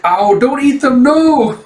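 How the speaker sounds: high, loud frantic plea; panicked shout. Acted. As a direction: shriek at them to stop. Pleading as monsters attack.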